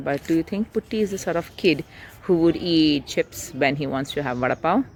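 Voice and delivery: ordinary delivery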